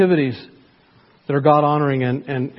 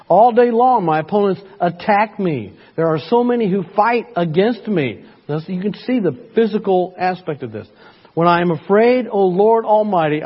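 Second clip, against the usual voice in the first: fervent psalm reading; 3 semitones higher than usual. Acted. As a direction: cry the psalm out as prayer. Dramatic scripture reading.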